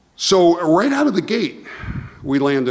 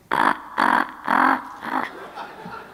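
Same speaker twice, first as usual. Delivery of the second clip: much lower, guttural mock guffaw; imitated mocking laugh; much slower than usual. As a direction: imitate the boss cracking up at him. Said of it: "He's laughing even harder".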